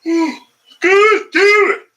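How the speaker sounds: high loud startled curse; jolted by the cat, cursing loud; sudden yelp. Real, not acted. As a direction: blurt it out in shock.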